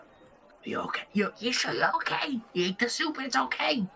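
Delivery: very soft, concerned and amazed; incredulous whisper. Acted. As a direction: whisper rising into a high, hushed question. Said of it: "she whispers to you".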